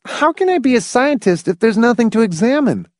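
put-on high, boyish complaint; protesting boy. A narrator's reading. Boy wanting things to examine, high protesting voice. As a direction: pitch it up like an aggrieved boy.

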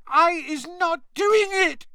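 very high squeaky elf voice, tearful; squealing house-elf. A narrator's reading. Terrified house-elf pleading with the ministry wizard, squealing. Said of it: "she squealed".

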